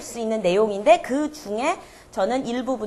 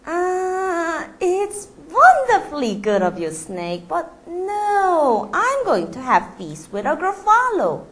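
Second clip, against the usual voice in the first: slow, lightly higher mouse voice; polite mouse; 7 semitones higher, much slower than usual. Acted. The mouse refusing the snake's invitation, politely.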